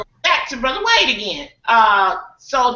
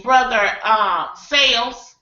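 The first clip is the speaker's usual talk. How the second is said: ordinary talk, nothing marked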